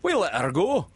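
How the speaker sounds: high, loud sidekick voice, pleased; complacent. A narrator's reading. Smee answering what he takes for a joke, complacent. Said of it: "Smee answered complacent".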